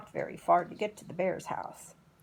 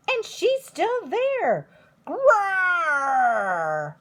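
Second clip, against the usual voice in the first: much higher then a loud growl; Baby Bear roar; 8 semitones higher, 9 dB louder, much slower than usual. A narrator's reading. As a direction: cub shrieks and roars. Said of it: "Somebody's been sleeping in my bed".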